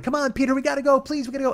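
put-on high, urgent girls' pleading; pleading girls. Girls begging their friend to leave, high and urgent. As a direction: beg him to leave, high.

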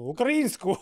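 high, loud laughing answer; laughing punchline. Real, not acted. Joking to cohost that Bulgarians learned Ukrainian, laughing. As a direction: deliver the punchline and burst into laughter.

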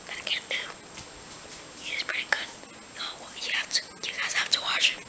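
hushed whisper so family sleeps; whisper, trying not to wake anyone. Real, not acted. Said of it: "I'm whispering 'cause, uh, my family is actually sleeping".

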